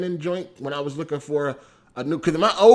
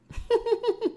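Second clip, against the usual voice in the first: high laugh; 4 dB quieter than usual. Real, not acted. Laughs at chat comment.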